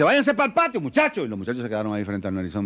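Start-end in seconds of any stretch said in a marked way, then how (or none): 0.0-1.2 high, urgent shoo under her breath; nervous hiss at kids. Acted. Mother urging her boys out from the kitchen, tense and high. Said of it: "Ella sale para la cocina y dice".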